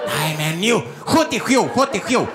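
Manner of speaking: mock Gotland dialect, number on repeat; mangled Gotland accent. Receptionist insists on room number, thick Gotland accent. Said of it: "stroke mitt i munnen".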